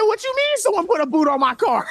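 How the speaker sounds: high, hammed-up protest; indignant player impression. Caster voices player whose car got booted, high mock protest. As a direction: squeal it in mock outrage. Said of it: "He said".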